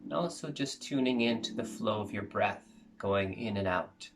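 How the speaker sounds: soft, calm meditation guide voice; guided meditation. Acted.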